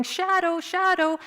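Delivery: high, loud calling; yelling. Acted. Dad and Chris chasing the dog, yelling. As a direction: yell the name after a runaway dog.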